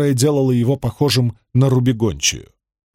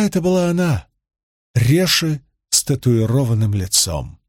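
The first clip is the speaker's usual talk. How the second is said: usual speaking voice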